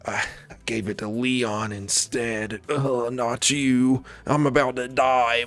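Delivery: a hammed-up dying sigh, half-hearted; dying Lewis. Lewis calls Ada as he's dying, lightly voiced mock groan. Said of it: "calling you up like".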